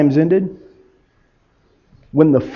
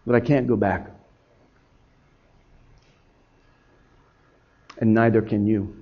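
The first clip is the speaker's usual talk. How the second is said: low, hushed and final; quiet, sober acceptance; resigned regret; 9 semitones lower, 11 dB quieter than usual. Acted. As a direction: let it fall softly, with a long pause. Peter accepts he cannot go back.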